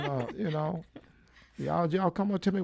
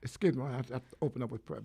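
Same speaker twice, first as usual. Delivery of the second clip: chewing while talking; 4 semitones lower, 6 dB quieter, faster than usual. Real, not acted. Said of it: "I'm eating, but go ahead".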